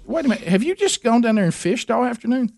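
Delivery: the wife realizing he went fishing; imitating wife. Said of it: "she goes".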